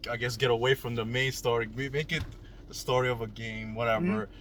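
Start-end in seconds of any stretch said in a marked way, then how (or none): none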